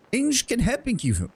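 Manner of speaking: high-pitched, lilting mock-German shopkeeper; bad German accent. Merchant inviting a customer inside, bad German accent. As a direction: lay on a terrible German accent. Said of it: "worst frickin' German accent".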